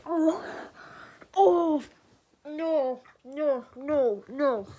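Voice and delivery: puckered, pained protests; struggling with the sourness; sour face. Real, not acted. Sour candy overwhelms him.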